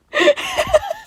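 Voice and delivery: real burst of high laughter; giggling at the end of the story. Real, not acted. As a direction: let a laugh break out high. Laugh after finishing the fic.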